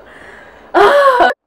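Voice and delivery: high choked laugh on the edge of tears; teary laugh. Real, not acted. Emotional laugh after "That's love.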